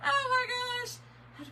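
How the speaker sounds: high, stretched-out through laughter; laughing hard. Real, not acted. Laughs with viewers over her singing, laughing hard. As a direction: squeal it out helplessly through real laughter.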